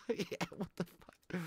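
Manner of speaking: ordinary delivery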